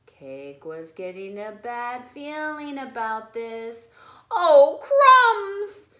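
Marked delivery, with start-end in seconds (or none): 4.3-5.9 alarmed. Acted. Cake alarmed by singing.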